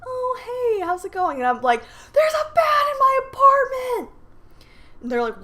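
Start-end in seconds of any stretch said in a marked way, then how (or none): 0.1-1.4 high, breezy mock mom greeting; mom answering phone. Mom picks up her daughter's panicked call, high casual hello. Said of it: "My mom answers the phone". 2.1-4.1 high, loud re-enacted shriek; panicked scream. Daughter phones her parents about a bat, hysterical acted scream. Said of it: "Again, I scream".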